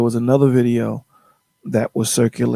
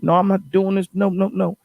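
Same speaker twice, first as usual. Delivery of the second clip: higher, stubborn no no no; defiant refusal; 6 semitones higher than usual. Retold or imitated. Retelling the date, she stubbornly refuses in a higher, defiant voice. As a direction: dig in her heels, mock defiant. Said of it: "Very defiant".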